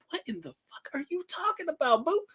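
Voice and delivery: incredulous. Retold or imitated. Retorting to girl's refusal.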